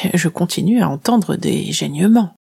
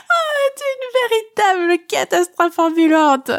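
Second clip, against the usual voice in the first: high giggling mockery, much higher; between fits of laughter; 13 semitones higher than usual. A narrator's reading. The shadow mocking the clumsy heroine, high laughing voice. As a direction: squeal it out through giggles. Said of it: "Elle me lâche entre deux fous rires".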